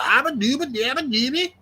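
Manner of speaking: high sing-song gibberish caricature; mock movie-slave talk. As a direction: mimic garbled film dialect as nonsense syllables.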